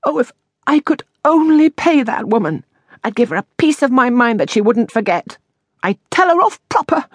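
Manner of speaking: high, aggrieved outburst; indignant railing. A narrator's reading. Miss Moss wishes she could pay landlady, high indignant rant. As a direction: rail in a high, wronged voice.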